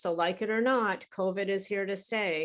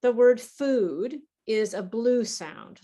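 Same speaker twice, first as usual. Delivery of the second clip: "food" stressed, louder and slow; slow emphatic teaching; 2 semitones higher, 6 dB louder, slower than usual. Shown as a demonstration. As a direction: spell out the blue sound slowly and loudly. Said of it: "For example".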